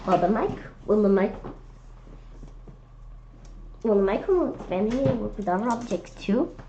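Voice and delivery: ordinary delivery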